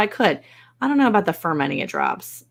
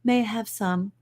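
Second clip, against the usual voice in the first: put-on posh child request; 2 semitones higher than usual. Kids asking mother for peach drizzle, prim posh child voice. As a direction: put on airs like a well-bred child. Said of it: "If they don't say it like that".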